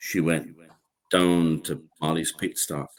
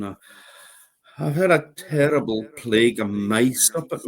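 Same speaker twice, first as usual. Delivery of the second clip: put-on high, wheedling beggar's voice of an old woman; pleading old woman; 8 semitones higher than usual. A narrator's reading. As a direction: whine for pity at the door. Old woman begging at the door.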